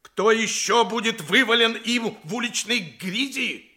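ordinary talk, nothing marked

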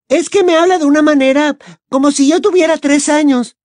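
put-on high, fed-up teenage girl; grumbling younger sister; Ginny. Ginny complaining.

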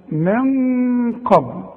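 very slow "من قبل", nasal n sustained; held nasal ghunna. Shown as a demonstration. Teacher models the nasal hold for a student, drawn out. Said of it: "تغن برضه".

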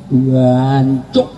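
drawn-out mock curse, rising at the end; East Javanese cursing. Shown as a demonstration. Showing East Javanese anger, hammed-up rising curse. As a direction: swear with relish, ending on an upswing. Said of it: "Apalagi Jawa Timur".

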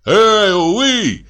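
high hail from another carriage; calling out. A narrator's reading. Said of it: "крикнули с другого тарантаса". (окрик издалека, высоким голосом)

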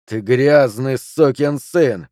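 slow, drawn-out angry curse; seething, stretched-out abuse; furious insult. Acted. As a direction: spit out each word of the insult. Junior insults Flint before swinging.

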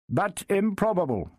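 sharp knight cutting in; knight interrupting. A narrator's reading. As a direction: break in as the knight. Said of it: "broke in Sir William".

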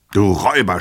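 angry yell at the servant; shout. A narrator's reading. Master shouting at his servant in anger. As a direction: shout out the insult. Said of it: "schrie ihn unser Held an".

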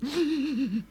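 high, short burst of laughter; gleeful laugh. Acted. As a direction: laugh at the butcher's expense. Guest mocks the fooled butcher.

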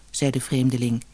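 ordinary delivery, nothing marked

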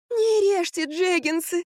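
high, slow pleading groan; moaning. A narrator's reading. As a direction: moan a drawn-out plea. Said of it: "простонала Марфа". (высокий умоляющий стон)

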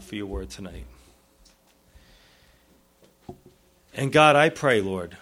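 earnest pastoral prayer, repeating Lord; closing prayer. Acted. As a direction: pray over the congregation gently.

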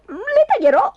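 high, forceful exclamation; high-pitched threat; determined wife. Acted. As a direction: declare it with fierce resolve. Wife threatens to cut sausages.

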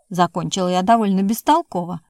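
ordinary delivery, nothing marked

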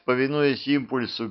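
plain reading voice

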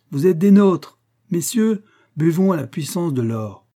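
high, ringing call to the guests; rousing toast. A narrator's reading. Banker rallying the guests to drink to gold, exuberant. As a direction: raise the voice to lead a toast.

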